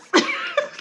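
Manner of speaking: a short laugh jumping way up; squealing high laugh. Real, not acted. Laughing at own rant.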